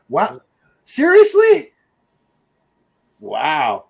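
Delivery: can't believe they've never seen it; shocked disbelief. Real, not acted. Friend never saw the film.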